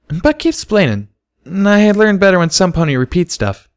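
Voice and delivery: put-on high childlike voice; high, eager little girl; little filly voice. A narrator's reading. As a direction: ask like an earnest little pony.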